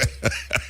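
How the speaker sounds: short soft breathy chuckle; amused laughter. Real, not acted. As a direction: chuckle on after teasing. Laughs on about the socks.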